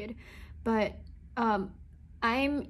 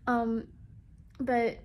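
normal talking voice

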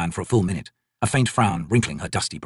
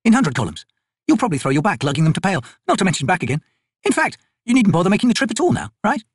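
higher, brisk soldier woman's voice; Picker, tough soldier; 8 semitones higher than usual. Acted. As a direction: voice the corporal, dry and sure of herself. Picker's voice in dialogue.

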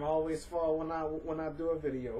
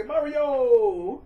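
vorsagend; 8 semitones higher, 13 dB louder than usual. Shown as a demonstration.

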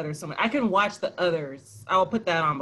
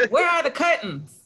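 hammed-up British movie line, pitched up; British accent; 7 semitones higher than usual. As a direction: cry it out in a playful British accent. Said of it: "that's my British accent".

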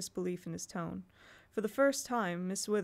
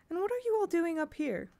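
higher, light and innocent question; innocent inquiry; 9 semitones higher than usual. A narrator's reading. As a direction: ask as if nothing's wrong, sweetly. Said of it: "inquired quite innocently".

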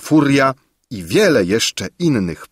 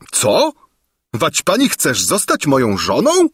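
higher, raised astonished cry; shouted; 6 semitones higher than usual. A narrator's reading. King shocked at Gburia Furia, raised voice. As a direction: shout it in disbelief. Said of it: "krzyknął Lulejka".

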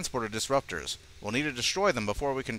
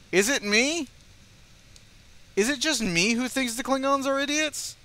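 pitched up high, incredulous; exasperated high rant; 12 semitones higher than usual. Real, not acted. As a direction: throw your hands up in disbelief. Ranting at the idiot Klingons.

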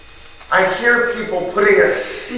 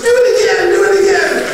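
high, loud and eager, asking for more; excited demand; 13 semitones higher, 4 dB louder than usual. Retold or imitated. Neighbor asks the boy to repeat the pitch, excited. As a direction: beg for an encore, much higher and louder.

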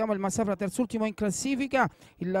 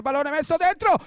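rising to a roaring goal shout; excited goal call; 5 semitones higher, 3 dB louder than usual. Real, not acted. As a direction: build up fast and explode on the goal. Goal call in live commentary.

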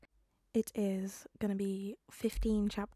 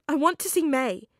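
higher and louder demand; 6 semitones higher, 10 dB louder than usual. Acted. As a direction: insist on seeing her. Peter demands to see May.

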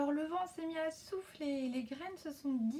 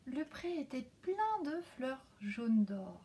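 plain reading voice

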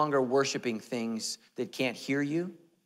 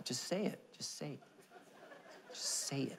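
playful coaxing; 3 semitones higher, 12 dB quieter than usual. Acted. Pastor begging his wife to admit he's funny, playful coaxing. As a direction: coax softly, teasingly.

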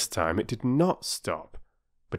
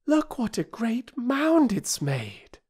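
high, slow marvelling; girl Bobby; slower than usual. A narrator's reading. Older sister showing the mound, high and slow. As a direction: point it out wide-eyed. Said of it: "said Bobby".

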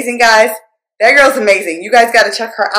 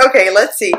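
usual speaking voice

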